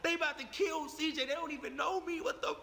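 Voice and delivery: high-pitched alarmed inner voice; retold fear. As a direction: replay the panicked thought that you may be killed. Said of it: "I'm scared as shit".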